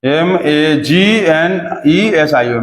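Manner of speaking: slow letter-by-letter dictation; spelling out. Shown as a demonstration. Teacher spelling magnesium for the class, slow dictation. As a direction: spell it slowly, one letter at a time.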